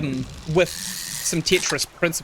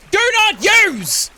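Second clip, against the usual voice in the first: loud, high, drawn-out shout; slow, high-pitched holler; shouted warning; 6 dB louder, much slower than usual. Acted. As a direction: bellow it like an urgent warning label. Reads out a warning label.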